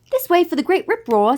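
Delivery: high, lively monkey voice; chimp guide. A narrator's reading. Chimpy guiding Maddie to the ride, high and eager. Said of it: "said Chimpy".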